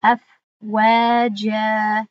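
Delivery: very slow recited word, the long vowel stretched; drawn-out tajweed reading; Quran recitation. Shown as a demonstration. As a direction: chant it with a held madd. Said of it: "در این مثال قبلی هم".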